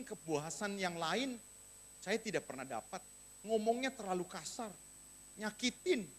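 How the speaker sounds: low, soft voicing of a disappointed wife; dissatisfied wife. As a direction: quietly confide a wife's hurt, lightly acted. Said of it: "istri kita ndak puas dengan saudara". (istri kecewa, diucapkan pelan dan rendah)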